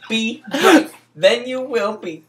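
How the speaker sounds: dramatic reading. Acted. Reading the hustler verses to his friend, preaching high. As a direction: proclaim every line like a sermon.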